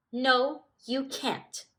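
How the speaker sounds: sharp, loud high retort; angry. Acted. Smudge refuses help with a loud angry no. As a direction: Smudge snaps back at Stripe's offer. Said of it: "looking angry".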